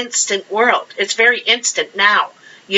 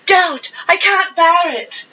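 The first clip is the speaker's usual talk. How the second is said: put-on old movie melodrama; 8 semitones higher than usual. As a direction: gush it like a swooning old-movie star. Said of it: "how they said everything so dramatic".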